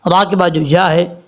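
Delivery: ordinary delivery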